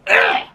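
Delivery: low guttural "ugh"; disgusted groan. Real, not acted. Host reacting to a horrible show, low disgusted groan.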